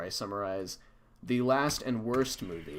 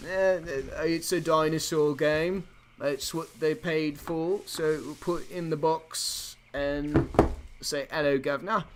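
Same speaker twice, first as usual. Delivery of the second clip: higher, slow, hammed-up British store clerk; exaggerated British accent, pitched up and drawn out; mock British accent; 6 semitones higher, 4 dB louder, slower than usual. Retelling a British gaming website guy's excuse, mock British accent. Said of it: "some guy at a British, uh, gaming website was like".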